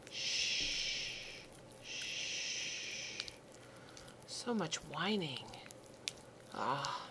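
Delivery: ordinary talk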